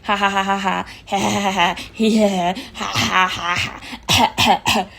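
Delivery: loud braying imitated laugh, ha ha ha; mock the host's nonstop horse-like laughing. Vlogger mocking the host's laugh, loud braying ha ha. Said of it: "घोड़े की तरह हंस रही है".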